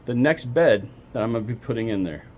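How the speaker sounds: ordinary talk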